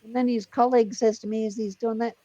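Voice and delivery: ordinary talk, nothing marked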